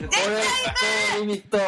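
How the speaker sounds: very high, loud cry; excited shout. Real, not acted. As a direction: scream the venue name. Hyped shout of the venue.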